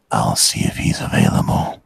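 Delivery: low whispered butler voice; creepy whisper. Pretend. Whispered put-on phone voice.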